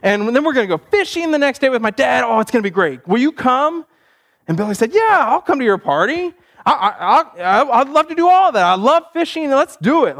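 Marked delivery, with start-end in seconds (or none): 0.0-3.9 higher-pitched, excited birthday invitation; eager kid inviting. Acted. Boy inviting his best friend to his birthday, eager. 4.9-10.2 high-pitched, delighted yes; eager acceptance. Acted. Billy saying yes to his friend's party, excited.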